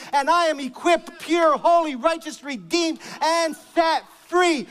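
rising fervent sermon climax; preaching at full force. Real, not acted.

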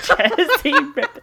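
put-on laughing stab at a Jersey accent; botched Jersey twang through laughter; bad Jersey accent. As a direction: mimic Jersey badly, high and loud. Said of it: "I don't even know what that accent is".

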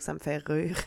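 ça me fait rire redone in a neutral French accent. Shown as a demonstration. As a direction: rire said carefully without the Quebec accent. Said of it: "je l'ai dit avec mon accent de Québec".

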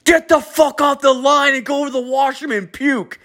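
very high mock boss yell; hammed-up high boss order. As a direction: squeal it like a boss losing it. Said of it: "my boss is like".

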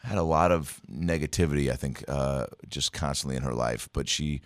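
normal talking voice

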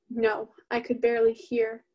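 usual speaking voice

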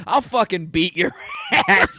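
laughing through a mock threat. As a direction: threaten while cracking up. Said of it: "I said".

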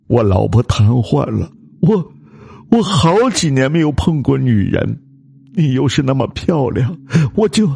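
high, tearful stammering confession; trembling, crying. Acted. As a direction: confess through sobs in mortal fear. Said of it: "老吴颤抖的声音哭道". (颤抖着哭诉)

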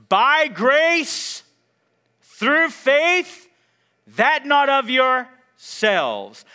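high, loud declaration; full-force preaching. Real, not acted. Preacher declaring to the church how they are saved, full-force preaching. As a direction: proclaim it loud and high, phrase by phrase.

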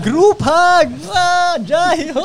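high, loud, drawn-out holler; party shout. Retold or imitated. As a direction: imitate a rowdy dancer yelling. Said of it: "Huwag mo namang isigaw".

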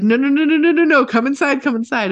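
high, loud urgent calling; mock panic. Owners calling the dog inside, high mock panic. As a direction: hurry the dog in, half-panicked. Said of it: "it was like".

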